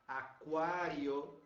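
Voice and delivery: "Aquário" said slowly and clearly; modelling the word for students; slow model word. Shown as a demonstration. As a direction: draw out "Aquário" syllable by syllable. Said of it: "com C U A".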